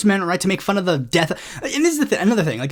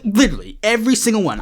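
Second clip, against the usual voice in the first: ordinary talk, nothing marked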